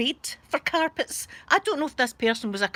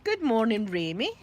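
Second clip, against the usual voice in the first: ordinary talk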